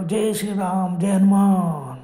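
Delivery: acted rallying cry with long held names; calling out. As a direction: chant it out to summon divine rescue. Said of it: "मी लगेच मोठ्याने ओरडलो".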